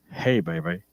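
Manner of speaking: low, soft mock seducer; seductive Zeus. Pastor mimicking Zeus seducing earthly women, low and soft. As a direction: croon it like a smooth pickup line. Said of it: "he would switch it up and be like".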